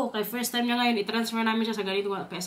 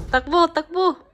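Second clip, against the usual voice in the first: excited calling out; 6 semitones higher, 8 dB louder than usual. Real, not acted. Cheering kids to run.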